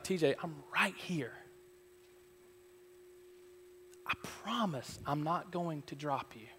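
soft, hushed reassurance; imitated divine whisper. God reassuring T J hanging on the limb, hushed voice. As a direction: promise it gently and quietly, with pauses. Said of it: "Spirit of God whispering that to you".

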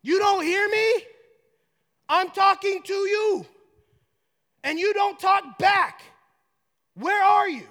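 high, loud, slow confrontation; anguished, exasperated acted outcry; shouting at God. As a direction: shout up to heaven, demanding an answer. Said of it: "David right here is like".

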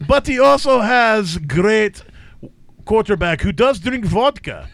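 put-on comic Russian accent. Host joking about bear-fighting players, Russian accent. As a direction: play a vodka-loving Russian, dropping the articles. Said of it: "Three is Russian".

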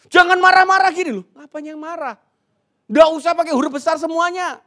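high, shouted mock quarrel; marah-marah. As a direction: act out an indignant row over text messages, yelling. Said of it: "itu artinya penegasan dan marah". (pura-pura marah, berteriak melengking)

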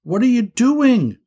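high, startled demand; shocked high-pitched question; alarmed shout. Acted. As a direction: yell up at a climber. Alarmed at brother up a tree.

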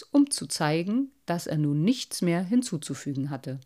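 ordinary delivery

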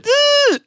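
high, loud groan of revulsion; sudden loud retching ugh; loud disgusted groan. Retold or imitated. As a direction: groan like you're about to be sick. Pizza and Guinness aftermath.

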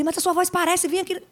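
a light imitation of the boyfriend urging her to sing.

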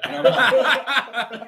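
slow laughing, drawn out; laughing too hard to go on. Real, not acted. Retelling to friends, slow laughing.

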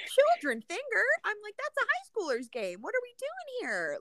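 high, incredulous mock voice; mock outrage. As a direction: scoff at the idea in disbelief. Mock reaction to Steve.

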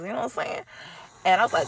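ordinary talk

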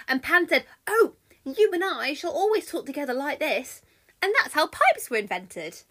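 loud, animated yarn-spinner; chatty Mercury storytelling. Acted. Mercury tells Argus how pipes began, animated and loud. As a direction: spin the tale with gushing emphasis.

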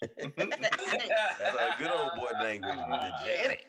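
high, slow words broken by laughter; cracking up while saying it; giggling out the joke; laughing through it. Real, not acted. Laughing at the name.

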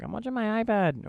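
imitating his son answering; little kid voice. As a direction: speak like a small child. Voicing his toddler son.

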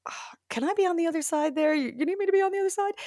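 high, rushed eager asking; eager pleading. Retold or imitated. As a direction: beg to get in front of the camera. Wanting to act, not film.

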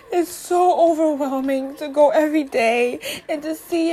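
crying through the words, fighting to keep talking; real crying. Real, not acted. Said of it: "I feel like I'm about to cry now".

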